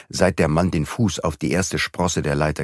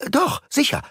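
determined. Acted. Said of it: "erwiderte sie entschlossen".

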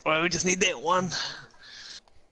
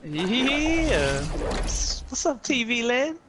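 hyped stream greeting; 8 semitones higher, much slower than usual. Real, not acted. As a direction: pump up the viewers.

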